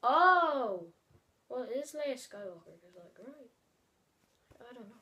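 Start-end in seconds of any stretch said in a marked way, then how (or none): none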